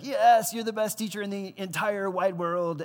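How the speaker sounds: high, loud mock cheer; cheering classmates. Classmates thanking the teacher, hammed-up high cheer. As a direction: whoop it out like a thrilled student. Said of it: "everybody's like".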